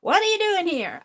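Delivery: tossing back his rude question; loud mock boss, lightly voiced; boss's challenge again. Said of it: "My mind is still".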